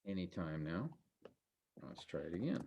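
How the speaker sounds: very soft, low aside; muttering to self. Real, not acted. Teacher to self while waiting for the text to load, muttering. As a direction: mutter it low, almost under the breath.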